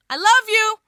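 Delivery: high, loud burst of love; shouted declaration. Acted. Defeating the dark lord with love, shouted. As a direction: hurl it like a spell.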